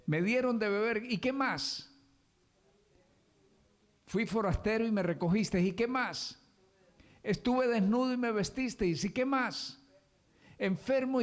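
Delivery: high, loud call-and-response preaching; full-force preaching. Acted.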